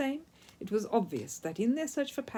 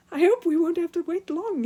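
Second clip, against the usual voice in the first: much higher, louder eager voice; excited; 11 semitones higher, 8 dB louder than usual. A narrator's reading. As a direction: bubble over with excitement. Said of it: "he exclaimed excitedly".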